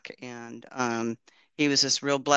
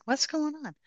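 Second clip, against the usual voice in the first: baffled; 14 semitones higher, 5 dB quieter than usual. Retold or imitated. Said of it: "I'm like".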